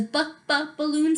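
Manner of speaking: "b, b" as separate short sounds before "balloon"; phonics-style "b, b" leading into "balloon"; sounding out. Shown as a demonstration. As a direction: isolate the first sound, "b, b", then say "balloon". Sounding out first letter.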